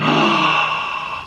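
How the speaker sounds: long, unvoiced gasp; big amazed intake of breath. Real, not acted. Host sees it looks like chicharrón, awed gasp.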